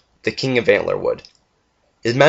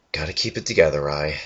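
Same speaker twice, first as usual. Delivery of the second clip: quiet self-reassurance; 6 semitones lower, 5 dB quieter than usual. A narrator's reading. Rai steadying himself after tears.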